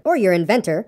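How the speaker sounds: ordinary delivery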